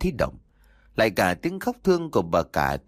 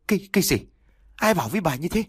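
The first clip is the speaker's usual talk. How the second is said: horrified disbelief; 7 semitones higher, faster than usual. A narrator's reading. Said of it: "trố mắt kinh hãi hỏi".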